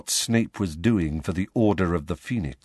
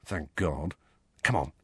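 soft, low relieved aside; hushed and low; quiet; 6 semitones lower, 7 dB quieter than usual. A narrator's reading. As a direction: mutter it low to a friend. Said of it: "Ron told Harry quietly".

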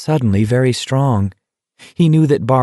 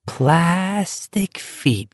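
"Plastic feet" drawn out, higher and softer; a softer, higher, slow "Plastic feet"; dreamy repeat; 8 semitones higher, 4 dB quieter, much slower than usual. Acted. Whispered phrase repeated dreamily.

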